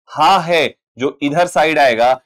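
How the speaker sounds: usual speaking voice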